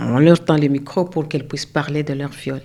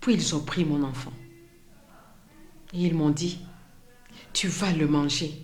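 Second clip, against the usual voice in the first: softly spoken survivor's testimony, long pauses between phrases; témoignage déclamé; 2 semitones higher, 11 dB quieter than usual. Acted. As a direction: recount it quietly as the woman who lived it. (témoignage dit à voix basse, avec retenue)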